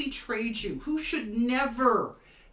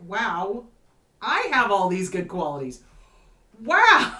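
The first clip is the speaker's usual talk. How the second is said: mock amazement; 9 dB louder than usual. Someone marvelling at their own mirrored qualities, delighted. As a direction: gush over your own good qualities.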